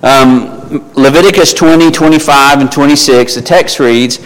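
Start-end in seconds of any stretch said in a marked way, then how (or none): none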